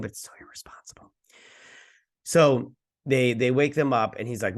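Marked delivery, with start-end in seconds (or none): 0.0-1.1 quick, whispered aside; hushed disapproving mutter to oneself; whispered disapproval. Retold or imitated. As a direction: drop to a fast whisper. Own disapproving aside, dropping to whisper.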